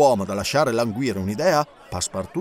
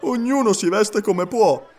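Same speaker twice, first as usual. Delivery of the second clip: higher, slow shrug of an answer; wry retort; 7 semitones higher, 4 dB louder, slower than usual. A narrator's reading. Passepartout's retort.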